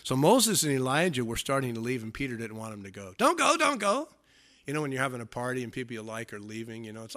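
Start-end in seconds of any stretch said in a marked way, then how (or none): 3.2-4.1 high-pitched mock plea; pleading. Peter begs Moses and Elijah to stay, pleading. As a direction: beg them to stay in a much higher voice. Peter begging guests not to leave.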